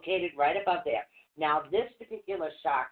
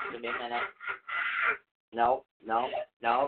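trying not to laugh; 6 semitones lower than usual. Real, not acted. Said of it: "I can keep a straight face".

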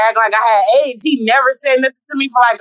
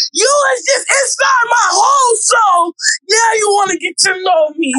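outraged rant; 7 semitones higher than usual. Real, not acted. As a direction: let it burst out in disbelief. Ranting about men's behavior.

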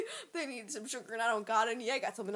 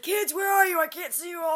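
put-on higher, louder call to lost kids; mock calling out; 8 semitones higher, 10 dB louder than usual. Drunk uncle calling for the kids, mock shout.

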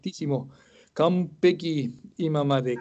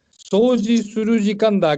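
raised, lightly announced call to clean; mildly performed classroom announcement; cleaning-time announcement; 5 semitones higher, 4 dB louder than usual. Retold or imitated. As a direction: announce it a little higher, stretched out. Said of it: "y yo digo".

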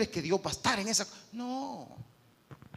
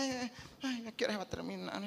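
bored sigh; 2 semitones higher, 8 dB quieter than usual. Retold or imitated. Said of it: "está así como".

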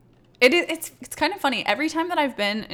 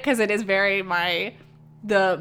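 ordinary talk, nothing marked

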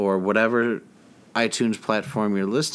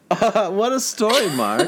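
Tommy Wiseau impression; 9 semitones higher than usual. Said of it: "And then Tommy goes like".

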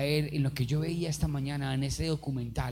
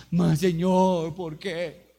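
crying plea; 6 dB louder, much slower than usual. Retold or imitated. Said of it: "lloraba y decía".

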